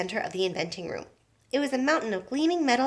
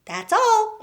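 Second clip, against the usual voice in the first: proud; 12 semitones higher, 6 dB louder than usual. A narrator's reading. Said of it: "gazing proudly at the results".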